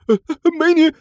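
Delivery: high, urgent pleading; anxious thug. Acted. Thug alarmed as heroine takes clothes, high urgent. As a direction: cry out in alarm. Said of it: "老二顿时急了".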